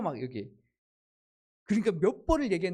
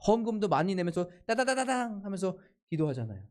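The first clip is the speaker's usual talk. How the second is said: ordinary talk, nothing marked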